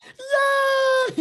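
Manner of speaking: very high, loud puppet cheer; Elmo cheer. Shown as a demonstration. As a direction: squeal yeah like Elmo. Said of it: "impression of Elmo".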